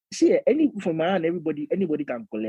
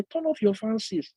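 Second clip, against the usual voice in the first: higher-pitched, softer imitation of a teacher; English teacher; 7 semitones higher, 5 dB quieter than usual. English teacher addressing a cold class, higher mimicked voice. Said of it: "My English teacher came to class one day".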